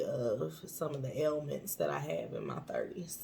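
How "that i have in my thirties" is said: whisper. Real, not acted. Whispered tail of sentence.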